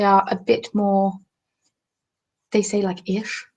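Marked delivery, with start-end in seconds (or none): 3.1-3.4 "ish" with a long, hushed sh; soft "sh" ending. Shown as a demonstration. Showing viewers a regional accent, soft sh ending. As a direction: draw out the sh in "ish". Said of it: "They're a bit more sh-y".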